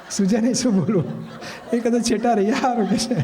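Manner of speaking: chuckling through the words; amused, laughing at the situation; a real laugh in the voice. Real, not acted. Laughing over the comic situation.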